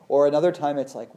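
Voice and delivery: ordinary talk, nothing marked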